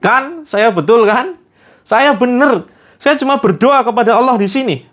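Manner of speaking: high, pleased, self-satisfied voice; smug told-you-so. Acted. Jabar smugly tells Kodar he was right, grinning. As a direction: claim victory with a smug grin in the voice. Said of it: "langsung tersenyum".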